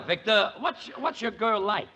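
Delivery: ordinary talk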